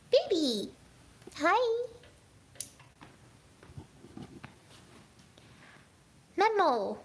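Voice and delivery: high, loud cooing calls to a cat; calling a pet. Real, not acted. Owner greeting the cat on camera, high pet-calling voice.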